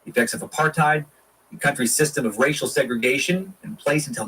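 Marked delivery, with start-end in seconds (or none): none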